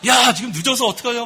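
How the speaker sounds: retold tirade, loud and high; worked-up parental scolding, imitated; acted angry yelling. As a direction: let loose at the latecomer, re-enacting it. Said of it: "소리를 버럭 지르고".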